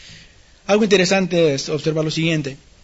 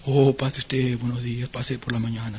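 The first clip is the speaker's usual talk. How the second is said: low, hushed mortuary usher; somber funeral voice; 7 semitones lower, 7 dB quieter than usual. Retold or imitated. Funeral usher welcomes mourners, somber and hushed. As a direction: usher them in softly with gloomy sympathy. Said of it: "una manera un poco tenebrosa y triste".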